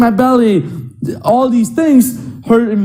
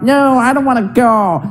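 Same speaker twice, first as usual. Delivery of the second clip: mock sleepy girlfriend; 4 semitones higher than usual. Girlfriend lying under blanket.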